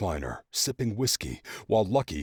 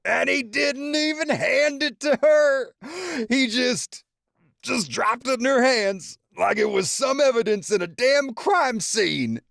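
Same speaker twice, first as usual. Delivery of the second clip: laughing to tears; 6 dB louder than usual. A narrator's reading. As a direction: tell it through tears of laughter. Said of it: "with tears in his eyes".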